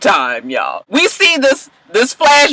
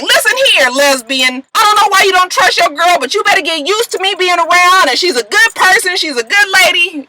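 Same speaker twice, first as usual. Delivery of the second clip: higher, pushy mock tough-guy rant; Lucius telling off; 9 semitones higher than usual. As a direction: lightly play Lucius laying down the law. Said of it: "snatches the phone out of her hand and is like".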